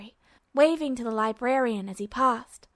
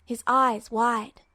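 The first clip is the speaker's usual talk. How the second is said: ordinary delivery, nothing marked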